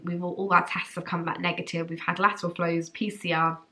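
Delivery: ordinary talk, nothing marked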